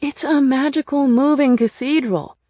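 loud, high, exasperated helplessness; helpless. A narrator's reading. Narrator answers Nick's questions about the fee, helpless. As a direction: protest that you have no answer. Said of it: "I said helplessly".

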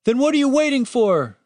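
high-pitched, impatient yell; pained shout. A narrator's reading. Wounded guard yelling at the cleric, impatient shout. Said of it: "shouted the wounded guard".

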